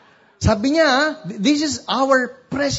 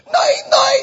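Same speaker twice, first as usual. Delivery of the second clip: high, loud screaming of a smitten fan; imitated fan shouting; 5 dB louder than usual. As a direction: scream to catch the idol's attention. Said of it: "she started shouting".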